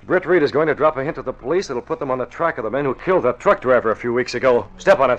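loud, pressing orders; urgent command. Acted. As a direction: hurry the driver along, loud and urgent. Urgent order to his driver.

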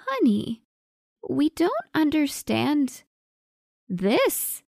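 mother gently searching for words; mother's voice. A narrator's reading. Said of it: "said her mother".

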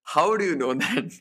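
retold disbelief, pitch lifted; shocked. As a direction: imitate someone caught off guard. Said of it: "he was just shocked".

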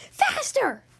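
high, loud coach yell; yelled order. Acted. Stern coach yelling at the running team, loud. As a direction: push them harder with a shout. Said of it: "yelled Coach Swamp".

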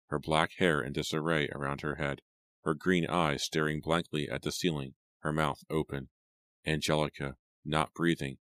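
plain reading voice

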